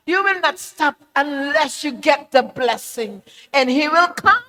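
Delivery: rising revival-preacher intensity; fervent preaching. Acted. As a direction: drive it home to the congregation. Preaching at full force.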